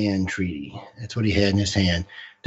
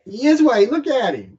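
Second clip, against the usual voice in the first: insistent; 11 semitones higher, 5 dB louder than usual. Real, not acted. Insists, raised voice.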